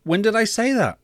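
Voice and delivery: high, incredulous question; challenging. Acted. God challenges the boy's claim.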